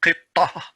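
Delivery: ha at the end of qittah; pausal form. Shown as a demonstration. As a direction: stop on qittah with an h, no t. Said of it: "if I'm going to stop".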